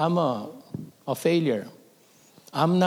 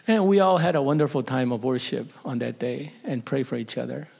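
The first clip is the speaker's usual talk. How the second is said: ordinary talk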